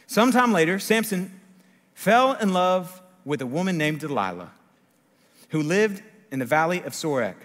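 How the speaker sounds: loud, high openings, projected to the room; scripture reading. A narrator's reading. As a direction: read the passage out boldly, phrase by phrase. Reads the Bible aloud.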